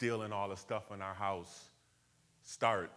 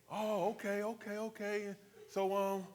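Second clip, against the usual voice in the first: much higher, hammed-up hesitant gang voice; gang member backing off; 11 semitones higher than usual. Gang member easing off the teen who claims Brooklyn, high mock voice. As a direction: back down with a high, unsure okay-okay.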